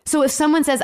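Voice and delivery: normal talking voice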